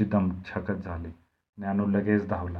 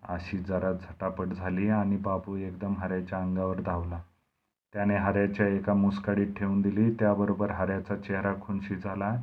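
ordinary delivery, nothing marked

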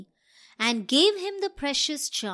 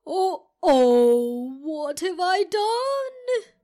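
loud, slow dismayed wail; dog crying out; 4 semitones higher, 4 dB louder, slower than usual. Acted. Dog crying out after losing the ring. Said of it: "cried the dog".

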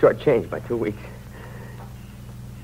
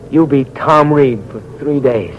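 louder, pressing the YOU; urgent last wish; 4 semitones higher, 5 dB louder than usual. Acted. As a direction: force out a final order with the last strength. Dying man's final command, louder.

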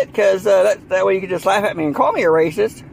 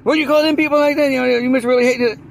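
mock taunting questioner; 5 semitones higher, faster than usual. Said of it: "and be like".

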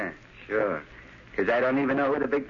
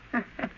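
mocking laugh; 9 semitones higher, 6 dB quieter than usual. Thug laughs at her.

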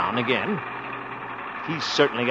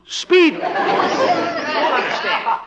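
incredulous; 14 semitones higher, much slower than usual. Acted. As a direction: pitch jumps high in disbelief. Baffled at slow tractor speed.

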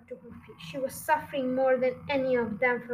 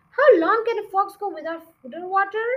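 high, loud and fast; eager question; 7 semitones higher, 8 dB louder, faster than usual. Acted. Bogus asking how long a fox can last, quick and high. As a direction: fire the question off in a rush. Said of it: "Bogus asked".

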